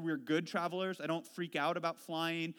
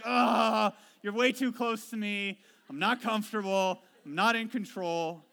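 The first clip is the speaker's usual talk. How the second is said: louder, higher exaggerated discomfort; mock complaint; 5 semitones higher, 8 dB louder than usual. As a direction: ham up being squashed by the seat. Retold complaint about cramped seat.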